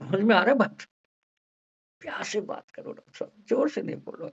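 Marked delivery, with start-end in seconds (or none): 2.0-4.3 soft, breathy pleading patient voice; lightly voiced gentle patient; sweet-talking patient. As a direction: coax the doctor gently. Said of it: "ऐसे करके बोलेगा।".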